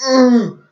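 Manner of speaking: high strained "mm" of effort; mock straining grunt. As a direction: grunt as if wrenching a hold. Imitates the botched submission hold.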